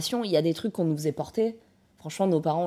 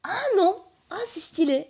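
surprised; 11 semitones higher than usual. Real, not acted. Reacts to beret look.

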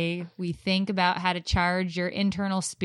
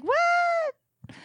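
mock surprise. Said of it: "And I was like".

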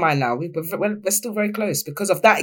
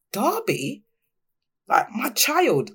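tearful disbelief; 6 semitones higher, much slower than usual. Retold or imitated. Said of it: "I just cried".

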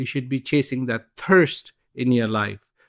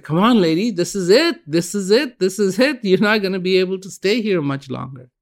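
higher, pressing call from a boat; urgent shout; 8 semitones higher than usual. Acted. Rescuer calls to the stubborn lady from a passing boat, urgent and higher. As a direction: hammer it home, this is it.

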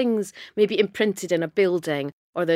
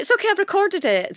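excited neighbour; 12 semitones higher, 4 dB louder than usual. Retold or imitated. Neighbour's excited reply, acted out.

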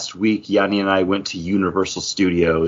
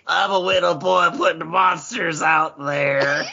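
put-on silly little boy voice; 8 semitones higher than usual. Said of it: "we're getting silly".

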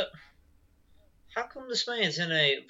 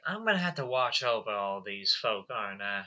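lower, fading mock sigh; weary cowboy Derek; 7 semitones lower, 8 dB quieter than usual. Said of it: "Derek is just like".

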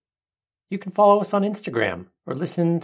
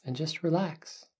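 soft spa whisper; 5 dB quieter than usual. Acted. Leads a breathing meditation.

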